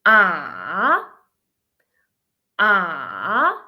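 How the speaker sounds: long drawn AH that dips and swings up; third tone, dipping. Shown as a demonstration. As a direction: sink down on AH, then sweep upward. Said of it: "The third one".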